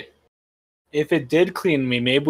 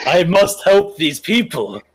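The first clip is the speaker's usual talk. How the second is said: mock noble hero; 4 semitones higher than usual. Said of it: "You're now lawful good".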